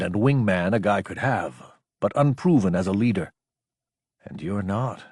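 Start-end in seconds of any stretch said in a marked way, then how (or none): 4.2-5.0 softer inner aside; quiet self-directed remark; admission to himself. A narrator's reading. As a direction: admit it privately with a shrug. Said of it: "he shrugged admission to himself".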